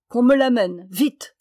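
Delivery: crier l'ordre plus fort; ordre furieux. A narrator's reading. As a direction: aboyer l'ordre, hors de lui. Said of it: "s'écrie-t-il". (le roi furieux ordonne qu'on lui amène le canard)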